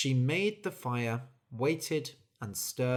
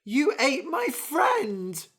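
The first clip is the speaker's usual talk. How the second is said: high, loud stricken cry; anguished cry; 13 semitones higher, 6 dB louder, slower than usual. Acted. Bird crying out on hearing its friend was eaten. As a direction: wail in horror. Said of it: "cried the bird".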